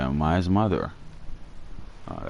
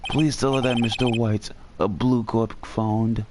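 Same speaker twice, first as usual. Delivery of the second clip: put-on higher, polite old-fashioned bellboy; chirpy butler-like bellhop delivering a message; Alfred voice; 5 semitones higher, slower than usual. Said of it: "That's a good bellboy voice".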